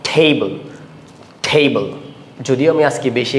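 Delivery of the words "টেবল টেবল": Table with its first syllable pressed hard; stress on first syllable. Shown as a demonstration. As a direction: lean on the opening syllable of table, let the second drop. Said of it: "stress first syllable".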